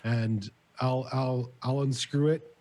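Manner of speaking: ordinary delivery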